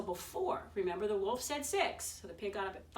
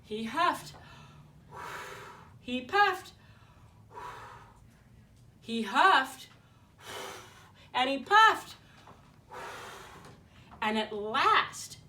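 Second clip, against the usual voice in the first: louder and louder with each huff; building huffing; 3 semitones higher, 7 dB louder, slower than usual. A narrator's reading. Wolf blowing house down.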